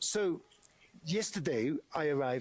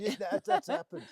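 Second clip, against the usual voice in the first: laughing; 8 semitones higher, 3 dB louder than usual. Real, not acted. Sympathetic laugh about altitude sickness.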